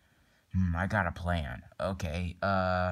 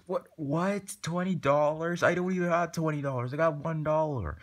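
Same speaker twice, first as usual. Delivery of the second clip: outraged disbelief; 8 semitones higher than usual. Acted. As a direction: balk at the price, pitch shooting up. Acted shock at the price.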